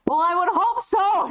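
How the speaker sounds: high, drawn-up mock indignation; retold indignant retort. Telling how grandma answered the gym teacher, mock indignant. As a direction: toss it back high, as the offended mom. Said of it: "she's like".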